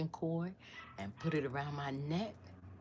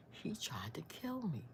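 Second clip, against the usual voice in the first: breathless whispered confession; whispering; 6 semitones higher, 5 dB quieter than usual. Retold or imitated. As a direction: whisper it through tears. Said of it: "I said".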